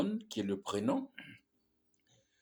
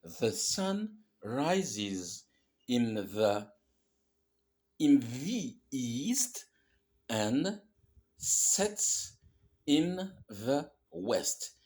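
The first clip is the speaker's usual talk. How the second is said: very slow, word by word, each sound spelled out; teacher slowly models the whole sentence; slow model reading; much slower than usual. Shown as a demonstration. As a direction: read it out carefully for beginners. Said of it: "on doit lire".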